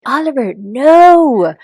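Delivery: high, loud, slow warning; drawn-out mock warning to a child. Mom warning her son Oliver in front of his class.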